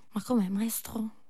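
shocked whisper; hushed dismay. Acted. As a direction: breathe it out in disbelief. Shocked aside to the master.

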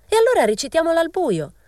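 a girl mocks the debate, shrieking; shrieked. A narrator's reading. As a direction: shriek it across the room. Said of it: "strillò una ragazza".

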